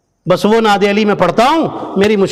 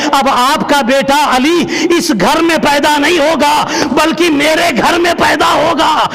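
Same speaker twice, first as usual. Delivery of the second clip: rising preached proclamation; 5 semitones higher than usual. Acted. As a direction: swell into a triumphant pronouncement. Preacher voices God's promise. (بلند ہوتی پرجوش خطابت، عروج پر پکار)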